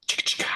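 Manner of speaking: breathy beatbox chicka chicka; whispered chicka beat. Acted. Beatboxing a song.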